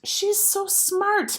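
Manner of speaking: high, slow, gushing mother voice; admiring mother. A narrator's reading. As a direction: gush over the girl. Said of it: "my mother says".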